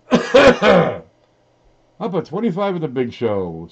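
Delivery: loud, high, lilting poem reading; poem recitation. Acted. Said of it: "Please read this like a poem".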